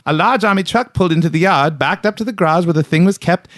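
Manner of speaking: high East Coast storyteller voice; East Coast accent. Retold or imitated. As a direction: read the paper quote like a Maritime local. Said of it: "that's not fucking bad".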